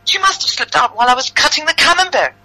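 very high, pleading protest; high-pitched protest. Acted. Accused woman defends herself to the mother, high protest. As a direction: swear it in a high voice.

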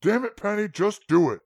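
sharp high command; urgent snap. Acted. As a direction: order him fiercely. Grandpa urgent order.

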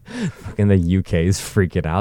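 normal talking voice

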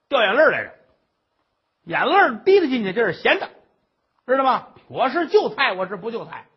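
loud, high nagging tirade; shrill scolding old mother. Acted. Mother-in-law scolds the crying daughter-in-law, loud and high.

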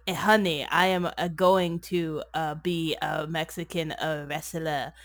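halting mock Mexican accent with extra "uh" sounds; terrible fake Mexican accent. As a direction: imitate an actor's bad fake Mexican accent. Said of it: "terrible fake accent the whole movie".

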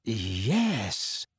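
soft, hushed word; low-key, awed hush; whispered. A narrator's reading. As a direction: breathe it out under your voice. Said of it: "he whispered".

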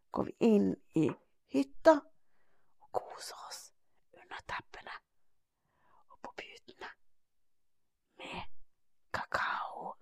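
put-on soft whisper as the children settle in. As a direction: breathe it like a bedtime secret. Settling in for sleep.

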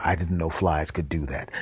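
ordinary talk

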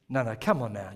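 ordinary delivery

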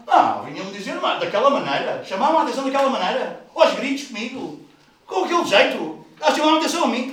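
high, loud, indignant whine; mock complaining churchgoer. Preacher mimics a churchgoer who felt yelled at, mock indignant. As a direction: parody someone offended by criticism.

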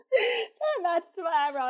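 a hint of a proud big sister, drawn out; sister's voice. Her sister after painting the eyebrow, a lightly voiced high slow impression.

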